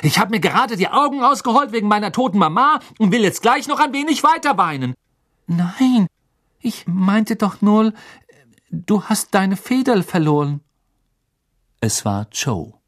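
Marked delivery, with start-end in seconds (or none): 0.0-4.9 high-pitched, sarcastic angry rant; exasperated tirade at a much raised pitch; fed-up outburst. A narrator's reading. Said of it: "Er hatte es jetzt satt". 5.5-10.6 put-on high, hesitant girl's voice; timid high voice with pauses between phrases; taken-aback girl. A narrator's reading. Cho taken aback by Harry's outburst.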